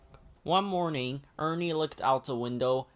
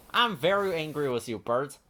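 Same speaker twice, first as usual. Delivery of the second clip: ordinary delivery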